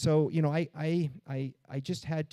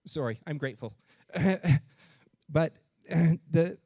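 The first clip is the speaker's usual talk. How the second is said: choked up; 3 semitones higher, 5 dB louder than usual. Real, not acted. Speaker apologizing to the audience while telling of a big account, choked up. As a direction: really overcome with gratitude, halting. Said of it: "didn't mean to get emotional".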